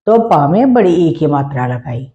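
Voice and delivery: ordinary talk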